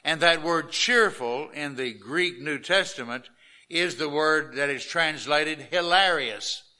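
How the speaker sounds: normal talking voice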